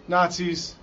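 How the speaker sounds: ordinary talk, nothing marked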